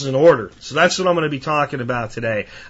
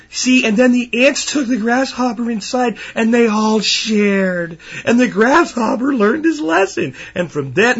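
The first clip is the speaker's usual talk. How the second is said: high, sing-song sugary storytelling, slowing down; mock storybook voice; 7 semitones higher, slower than usual. Mocking the sweet version parents tell children, high and syrupy. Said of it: "where we tell the children".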